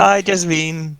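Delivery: high put-on greeting; Casimir. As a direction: greet in someone else's high voice. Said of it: "do Casimir voice".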